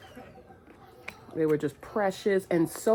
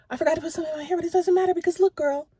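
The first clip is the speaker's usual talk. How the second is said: much higher, giddy take on "hair" rising; carefree higher retake; excited repeat; 13 semitones higher, 4 dB louder, much faster than usual. Shown as a demonstration. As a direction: toss it off with a bright upswing. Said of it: "repeated line".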